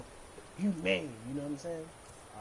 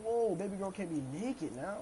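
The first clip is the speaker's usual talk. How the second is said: usual speaking voice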